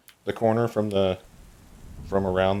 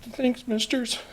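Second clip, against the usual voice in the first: put-on very high, small tearful child; crying young girl; slower than usual. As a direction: thank them in a little girl's crying voice. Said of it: "just crying".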